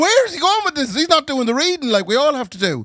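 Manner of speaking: high-pitched incredulous questioning; mock bafflement. Congregation baffled by the reader going off script, mock incredulity.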